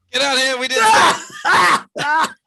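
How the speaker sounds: high, loud shouted summons; shouting. Acted. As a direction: yell it through the door. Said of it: "just shout".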